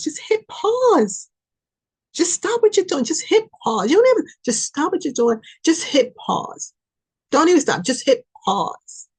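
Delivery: urgent command. Acted. Reader urges the viewer to pause, insistent. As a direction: tell them to stop right now.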